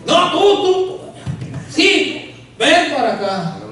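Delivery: high, laughing mock call; mock preacher call. Retelling the preacher singling out the scared man. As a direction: point him out and call him up. Imitating preacher calling him forward.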